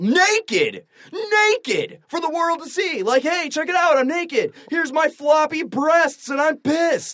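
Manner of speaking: high-pitched mock outrage; incredulous, hammed-up exclaiming; indignant mimicking rant. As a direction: act out her brazen outburst, voice pushed way up. Indignant rant, mimicking the naked woman.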